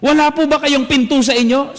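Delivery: a brat heckling the priest; shouted taunt, imitated; retold shout. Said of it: "sinigawan po ako".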